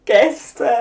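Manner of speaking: high, loud, slow theatrical lament; hammy stage actor. Pretend. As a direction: overplay the grief like a stage actor. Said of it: "so mega theatralisch".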